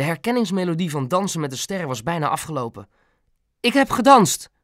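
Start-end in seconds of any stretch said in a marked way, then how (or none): 3.6-4.5 higher, louder sudden blurt. A narrator's reading. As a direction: blurt it out in a raised pitch. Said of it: "flapte hij eruit". (eruit flappen)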